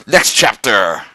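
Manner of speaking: ordinary delivery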